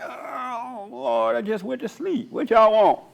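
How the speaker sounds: a hint of a groggy, annoyed man; Jesus woken from his nap, grumbling; grumpy woken Christ. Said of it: "And he's like".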